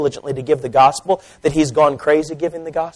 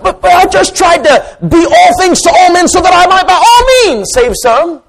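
loud, high, driving preacher's cry building to a shout; preaching at full force; 12 semitones higher, 8 dB louder than usual. Acted. Preacher thundering Paul's words to the congregation, loud and building. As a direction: thunder the verses out to the church.

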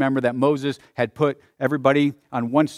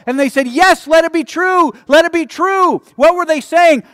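loud, high pulpit cry; impassioned preacher, shouting the declarations; full-force preaching; 12 semitones higher, 10 dB louder than usual. Real, not acted. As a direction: drive it home to the whole congregation.

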